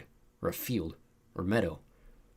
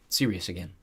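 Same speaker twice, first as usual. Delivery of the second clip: ordinary delivery, nothing marked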